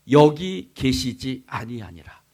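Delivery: slow, measured scripture line; recitation for repeating. Shown as a demonstration. Preacher leading the congregation to repeat, slowly recited. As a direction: spell it out slowly for people to repeat. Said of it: "따라해 봅시다".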